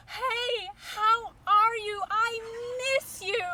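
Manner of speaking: high, very loud drawn-out holler; excited yelling. Retold or imitated. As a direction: yell an ecstatic greeting across to a friend. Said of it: "I'm yelling to her".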